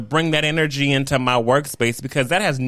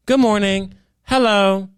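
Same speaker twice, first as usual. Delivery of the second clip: bright greeting; 6 semitones higher, 4 dB louder, slower than usual. Shown as a demonstration. Her own deliberate office greeting.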